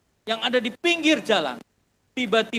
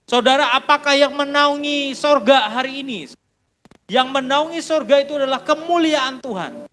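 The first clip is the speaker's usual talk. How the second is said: preaching build; 4 semitones higher, 5 dB louder than usual. Acted. Preaching question at full force.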